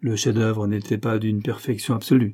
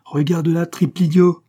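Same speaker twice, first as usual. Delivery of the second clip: loud, high outburst; raised voice, furious boss; angry scolding; 7 semitones higher, 7 dB louder than usual. A narrator's reading. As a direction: berate your man in exasperation. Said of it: "grogna l'adjoint".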